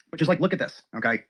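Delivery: ordinary talk, nothing marked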